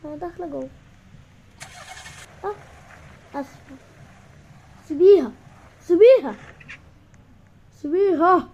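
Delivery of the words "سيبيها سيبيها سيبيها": angry shout. Acted. Brother yelling at sister to leave him alone, angry shout. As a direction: yell it higher and louder each time.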